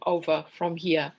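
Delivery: normal talking voice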